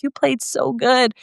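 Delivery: put-on high, sweet little girl; young girl voice. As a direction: voice an excited young fan. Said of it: "young girl will be like".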